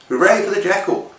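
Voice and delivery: high-pitched hooray; mock cheer. Storyteller cheers the jackal at story's end, mock cheer.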